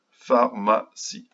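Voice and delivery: "Pharmacie" slowly, in three distinct syllables. Shown as a demonstration. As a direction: separate each syllable of "Pharmacie". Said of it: "On dessine les syllabes".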